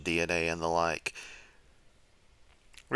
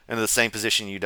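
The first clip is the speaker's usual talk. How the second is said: ordinary delivery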